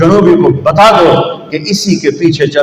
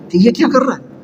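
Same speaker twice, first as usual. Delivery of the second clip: a high, startled cry from a mother, a little softer; 8 semitones higher, 5 dB quieter, much faster than usual. Retold or imitated. Mother cradling her newborn cries out in high astonishment. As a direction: gasp it out like an amazed mother.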